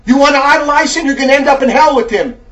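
loud, forceful rebuke with hard-hit words; fiery preaching. Real, not acted. Preacher scolding a letter writer over race and faith, fiery rebuke. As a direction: preach it hard at the wayward listener.

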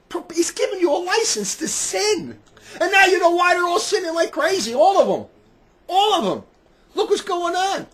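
high, loud indignant cry; incensed, high-pitched preaching shout; outraged rant. Real, not acted. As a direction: denounce it at the top of your range. Outrage at license to sin.

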